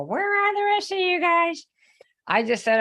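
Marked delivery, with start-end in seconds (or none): 0.0-1.7 high-pitched wail of dismay; put-on plaintive whine, much higher; mock crying complaint. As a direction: cry it out like a child left in the lurch, hammed up. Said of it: "sit down and cry or go".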